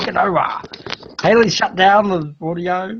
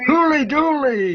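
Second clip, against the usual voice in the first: mock Aussie exclamation; 9 semitones higher than usual.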